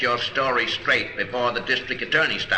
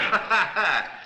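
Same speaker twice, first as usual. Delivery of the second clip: higher, a quick laugh of triumph; triumphant laugh; 7 semitones higher than usual. Acted. Detective laughs at the banker's mixed-up dates. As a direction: laugh as the trap snaps shut. Detective springs the alibi trap.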